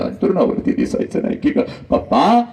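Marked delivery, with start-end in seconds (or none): 1.9-2.4 daughter calling papa. Acted.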